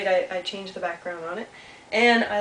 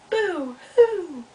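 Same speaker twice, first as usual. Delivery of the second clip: high boo-hoo echoing back; echo of crying; 8 semitones higher, 4 dB louder than usual. Acted. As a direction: send the wail back like an echo across a pond. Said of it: "Little Beaver heard something".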